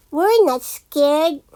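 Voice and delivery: plain reading voice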